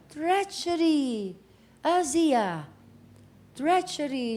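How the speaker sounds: high, drawn-out calling out; acted cry of alarm. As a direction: cry out a warning as if fleeing. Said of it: "Tapos sumisigaw siya".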